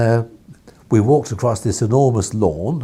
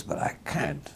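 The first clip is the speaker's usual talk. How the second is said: a light imitation of the baron's accent, pitched higher; lightly put-on old press baron accent, higher and soft; imitating Beaverbrook's accent; 7 semitones higher, 10 dB quieter than usual. Editor imitating Beaverbrook's greeting, in a light take on his accent. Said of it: "I'm doing my best to imitate his accent".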